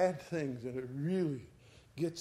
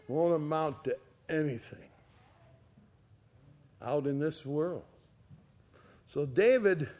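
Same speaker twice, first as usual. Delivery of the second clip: usual speaking voice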